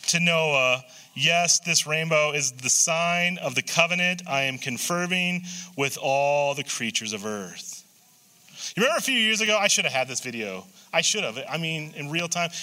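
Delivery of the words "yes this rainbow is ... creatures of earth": measured, unhurried Bible reading; scripture reading. Acted. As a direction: read the passage slowly and solemnly. Reads Genesis nine aloud.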